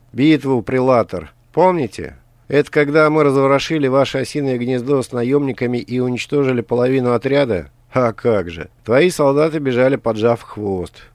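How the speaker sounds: mocking taunt. A narrator's reading. Rival taunting back.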